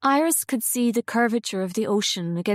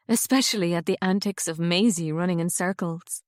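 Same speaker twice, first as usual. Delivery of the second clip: ordinary delivery, nothing marked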